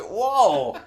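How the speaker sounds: high, loud laughing whoa; mock shocked whoa. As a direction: react in fake shock, laughing. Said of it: "I'm like".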